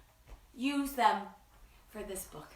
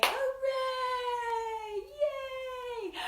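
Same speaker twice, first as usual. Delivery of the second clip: cheer; 11 semitones higher, 4 dB quieter, much slower than usual. Acted. Cheering after the song.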